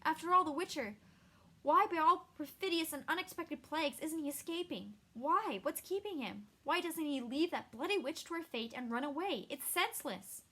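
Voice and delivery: high, loud, panicked questions; frantic wail. Acted. Dandelion to Krept, not understanding why Geralt stays, frantic high wail. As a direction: plead in frantic disbelief. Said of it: "wailed Dandelion".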